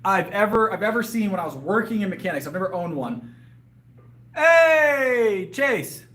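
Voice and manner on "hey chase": calling out. Real, not acted. Calling out to arriving friend.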